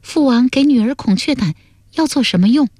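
high-pitched princess voice; questioning daughter. Acted. Daughter asking what the poison is for, high-pitched questioning. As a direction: ask in a high, puzzled daughter's voice.

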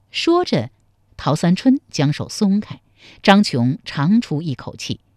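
ordinary delivery, nothing marked

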